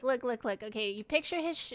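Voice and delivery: usual speaking voice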